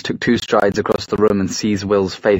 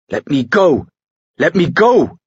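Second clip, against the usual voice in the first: rising, louder plea to be let go; struggling shout; 7 semitones higher, 4 dB louder than usual. Acted. Will dragged from the room, shouting to be let go. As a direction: fight the grip and yell, building higher.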